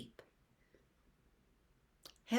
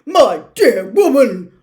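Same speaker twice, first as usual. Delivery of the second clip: much louder, slower pompous roar; 4 semitones higher, 20 dB louder, slower than usual. Acted. Minister roaring at McGonagall, furious. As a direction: roar it down at her. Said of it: "roared Fudge".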